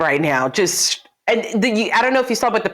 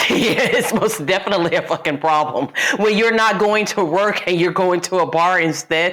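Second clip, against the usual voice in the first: normal talking voice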